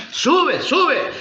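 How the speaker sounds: high, urging cries of false prophets; eager prophets. Acted. False prophets urging king Acab, high and eager. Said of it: "los cuatrocientos que le decían".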